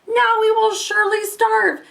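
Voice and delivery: high wailing mother, close to tears; despairing cry. A narrator's reading. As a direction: lament the coming hunger. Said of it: "she cried".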